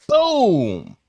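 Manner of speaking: excited explosive boom; boom sound effect. Acted. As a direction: pop it like a blast.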